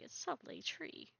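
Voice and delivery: soft whispered aside to herself. Real, not acted. Admiring a tree to herself, whispering. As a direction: whisper it under your breath.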